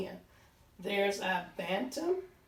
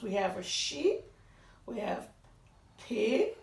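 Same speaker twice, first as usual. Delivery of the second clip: usual speaking voice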